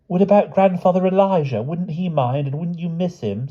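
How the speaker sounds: put-on high, pleading child character voice; worried Charlotte. A narrator's reading. Charlotte pleading with the other children about their families, worried. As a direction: raise the pitch and press the anxious questions.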